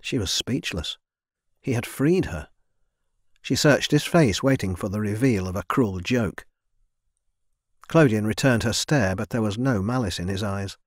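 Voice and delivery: ordinary delivery, nothing marked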